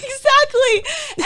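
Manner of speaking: high, slow, laughing through the word; exactly through laughter. Real, not acted. Laughing agreement.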